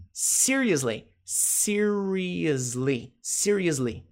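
"Seriously" stretched out very slowly; slow-motion pronunciation model; slow model word. Shown as a demonstration. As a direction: draw out every syllable for learners.

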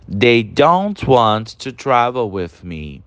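slow, careful read of "don't" sentence; slow model sentence. Shown as a demonstration. Teacher modelling the contracted sentence, slow and careful. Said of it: "a forma contraída".

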